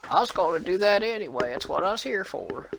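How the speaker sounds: high-pitched eager greeter voice; chirpy old-timer answer; old greeter reply. Retold or imitated. Geriatric greeter answering the manager, high and eager.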